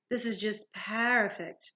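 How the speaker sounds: put-on slow, drawn-out French-accented gloat. A narrator's reading. Manticore has ambushed the heroes, gloating. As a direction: savor it as the villain, lingering on the words. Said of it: "the Manticore gloated".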